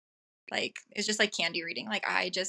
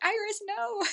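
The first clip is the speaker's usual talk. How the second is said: exasperated quote; 12 semitones higher, slower than usual. Retold or imitated. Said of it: "I was like".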